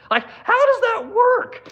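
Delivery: high, laughing disbelief; incredulous laugh. Real, not acted. Venting at Congress, laughing in disbelief. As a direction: laugh it out, stunned.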